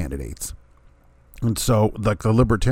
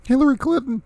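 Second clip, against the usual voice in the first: incredulous reveal. Real, not acted. Punchline of incredulous setup.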